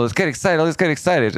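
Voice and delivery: high-pitched hype, lightly put on; mock excitement. Trader hyping the fifteen minute chart, mock excited.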